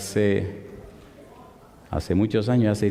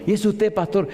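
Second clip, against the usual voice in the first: higher, sweetly polite question; softened tone; 8 semitones higher than usual. Retold or imitated. The professor now friendly to the pastor, sweetly polite. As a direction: turn suddenly courteous. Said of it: "ya cambió el tono".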